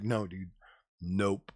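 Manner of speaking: low, soft deadpan no dude, nope; flat refusal. Retold or imitated. Streamer refusing to buy top end, soft deadpan nope. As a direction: drop it low and shut it down. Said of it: "And it's like".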